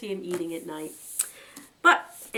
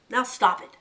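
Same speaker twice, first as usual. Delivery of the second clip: louder, firm command; 5 semitones higher, 8 dB louder than usual. Acted. Hazel calming frightened Fiver, firm. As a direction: put a stop to the panic, steady and stern. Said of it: "said Hazel firmly".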